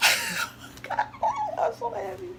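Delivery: very high, squealing with joy; excited squeal. Real, not acted. Thrilled with her new wig, squealing happily. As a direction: squeal like you can't contain it. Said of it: "Okay, let me calm down".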